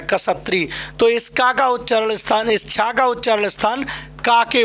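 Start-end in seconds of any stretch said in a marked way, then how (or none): 0.0-0.7 "कसत्री" as ka-sa-tri, falling at the end; corrected kshatri. Shown as a demonstration. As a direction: sound both k and s in "कसत्री". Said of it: "छा को कसा बोला जाता है".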